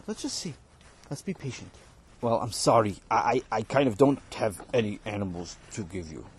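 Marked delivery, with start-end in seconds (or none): 2.2-6.3 lower, flat refusal from the boss; stingy employer. Acted. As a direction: brush him off from a low register.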